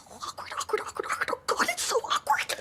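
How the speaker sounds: unvoiced-sounding awkward hiss under the breath; cringing whisper. Real, not acted. Vlogger cringing at the silence for Sydney, awkward whisper. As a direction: whisper it fast and squirming, as an aside.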